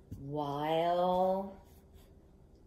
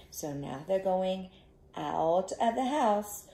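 plain reading voice

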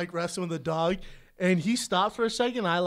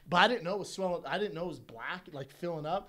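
usual speaking voice

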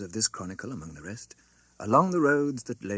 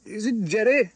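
higher, slightly uneasy voice; mildly quavering; 11 semitones higher than usual. A narrator's reading. Passenger asking who wants him, mildly quavering. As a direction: ask with a small tremor of nerves. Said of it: "with mildly quavering speech".

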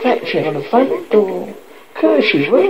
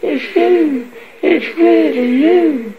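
high, drawn-out disbelief and relief; overjoyed cry; 7 semitones higher, slower than usual. A narrator's reading. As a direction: marvel at a son's return. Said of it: "she cried".

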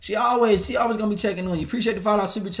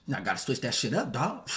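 ordinary talk

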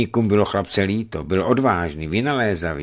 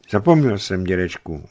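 ordinary delivery

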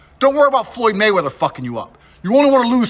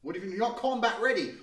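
ordinary talk

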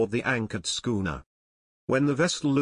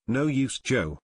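plain reading voice

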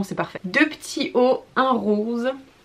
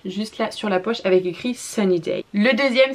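ordinary delivery

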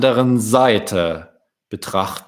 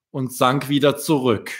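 ordinary delivery, nothing marked

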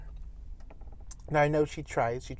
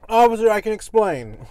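pleading with police; 4 semitones higher, 8 dB louder than usual. Pretend. Mock caught by police.

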